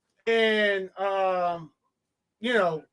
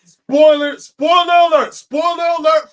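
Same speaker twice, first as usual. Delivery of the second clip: loud, high alert call; shouted warning; 7 semitones higher, 10 dB louder than usual. Real, not acted. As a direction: holler it out to warn every listener. Calling out a warning to listeners.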